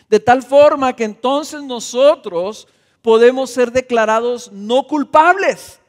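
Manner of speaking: loud, high, impassioned preaching that drives each phrase home; preaching at full force. Acted. Pastor preaching the cross to the congregation, at full force.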